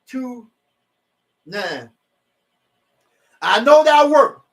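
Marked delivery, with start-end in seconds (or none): 3.4-4.4 booming scripture read; forceful Bible reading; loud scripture reading. Real, not acted. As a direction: proclaim the verse loudly.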